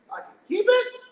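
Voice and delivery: high, loud disbelieving question; can't believe the luck; disbelief. Retold or imitated. Asking the friend about the free car, stunned disbelief.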